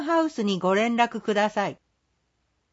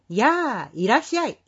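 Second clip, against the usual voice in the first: put-on a cheerful, louder welcome to the children; greeting kids louder. Shop owner welcoming kids, a bit louder. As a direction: call out hello brightly at the shop. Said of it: "と声まで大きくなります".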